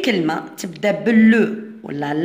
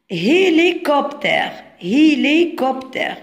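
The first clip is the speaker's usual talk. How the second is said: "Hélicoptère" slow and higher, every syllable distinct; sounding out; 5 semitones higher, slower than usual. Shown as a demonstration. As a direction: spell out "Hélicoptère" by ear, syllable by syllable. Teacher sounds out word for pupils. ("Hélicoptère" ببطء، مقطع بمقطع)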